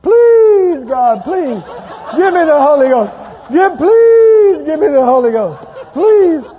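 loud, laughing, begging God for the Holy Ghost; loud laughing plea for the Holy Ghost; desperate pleading prayer. Retold or imitated. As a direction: plead with God at full volume, half laughing. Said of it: "And I'm saying".

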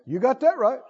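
normal talking voice

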